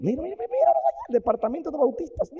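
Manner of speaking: high, loud crowing guide; gleeful shout. Acted. Hell's guide shouting to Wesley about burning crowds. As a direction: yell it out like a showman.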